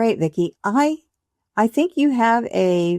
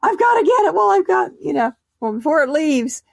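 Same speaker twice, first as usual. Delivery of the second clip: high, hurried mock panic; acted rush, pitched well up; frantic urgency; 9 semitones higher than usual. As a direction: blurt it as if dashing to catch an idea. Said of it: "you're dashing to the laptop like".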